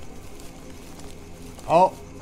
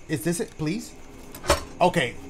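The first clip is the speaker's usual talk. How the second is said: ordinary talk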